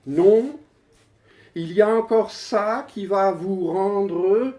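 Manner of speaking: high-pitched, emphatic recitation; poem declamation. Acted. Poet proclaims staggering computer figures to the audience, declaimed. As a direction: proclaim each figure with suspenseful pauses.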